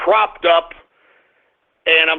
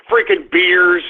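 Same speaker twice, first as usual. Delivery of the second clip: ordinary delivery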